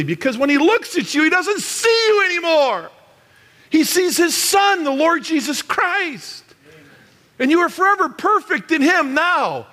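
high, forceful pulpit delivery; loud impassioned preaching. Real, not acted. Preacher telling guests they are perfect in Christ, loud impassioned preaching. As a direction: preach it with full conviction, pitch soaring. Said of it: "I don't get that loud".

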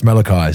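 "Malachi" as MAL-uh-kye; corrected pronunciation. Shown as a demonstration. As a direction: stress the start of "Malachi", rhyme the end with "eye". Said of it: "It's not Malaki, evidently".

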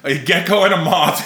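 ordinary talk, nothing marked

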